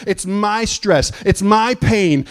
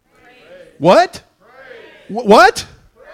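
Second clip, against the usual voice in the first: call and response; 4 semitones higher, 7 dB louder, much slower than usual. Acted.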